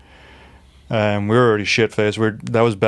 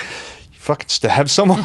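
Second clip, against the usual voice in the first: mock shock; 4 semitones higher than usual. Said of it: "being sober is like".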